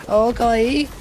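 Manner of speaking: high, drawn-out anime voice; anime character. Acted. Streamer showing viewers how anime Japanese people talk, anime character voice. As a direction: put on an exaggerated anime-style squeal. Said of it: "Japanese people from anime, like".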